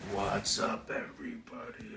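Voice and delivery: soft unvoiced whisper of the greeting; playful whisper. Shown as a demonstration. Streamer whispering a teasing hello to viewers. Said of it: "same greeting redone whispered".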